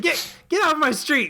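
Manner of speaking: high, slow mock yell; drunk angry actor. Podcaster quotes drunk actor to intruders, mock-indignant and high. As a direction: imitate a drunk ordering people away, drawn out. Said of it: "you've got a very, very drunk".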